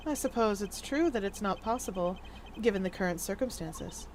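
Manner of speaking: low, very soft character voice; hushed, low, deliberate reasoning; cool, measured concession. Acted. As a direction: concede it quietly, with careful composure.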